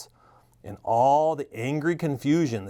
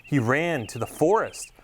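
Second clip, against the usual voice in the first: ordinary delivery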